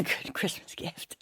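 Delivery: slightly hushed, confidential aside; whispering. Real, not acted. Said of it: "I'm whispering".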